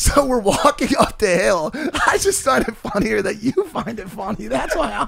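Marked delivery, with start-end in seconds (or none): 1.7-5.1 talking through real laughter; laughing. Real, not acted. Amused by a friend finding his joke funny, laughing.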